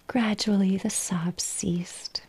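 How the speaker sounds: ordinary delivery, nothing marked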